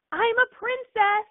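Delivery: put-on child's high excited shout, slow; retold yell. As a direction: shout it out like a proud little girl. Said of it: "yelled at the top of her lungs".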